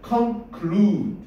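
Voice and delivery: conclude의 u를 길게 끌기; conclude를 '컨클루-드'로 천천히. Shown as a demonstration. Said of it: "이렇게 길게 해주라". (conclude의 '루'를 길게)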